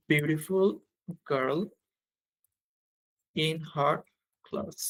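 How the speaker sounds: long gaps between small word groups; slow dictation. Real, not acted. Teacher writing the superlative example, slow word-by-word dictation. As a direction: stretch it out in time with writing.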